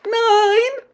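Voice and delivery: very high, loud screeched countdown; witch countdown. A narrator's reading. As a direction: count down in a witchy shriek to a triumphant finish.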